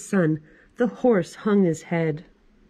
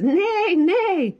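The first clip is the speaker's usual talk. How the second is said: high horse whinny; neighing horse; 11 semitones higher than usual. Acted. Horse at the library, whinnying high.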